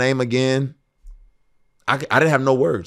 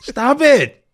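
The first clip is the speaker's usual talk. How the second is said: higher, louder laughing stop it; playful loud protest; 10 semitones higher, 7 dB louder than usual. Real, not acted. Artist overwhelmed by his friend's praise, loud playful protest. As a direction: beg them to stop, grinning.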